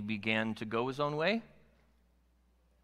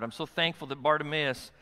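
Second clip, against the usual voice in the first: usual speaking voice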